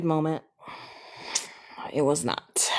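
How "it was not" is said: hushed, embarrassed aside; whispered aside. Real, not acted. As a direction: whisper it to yourself. Whispered aside after embarrassing story.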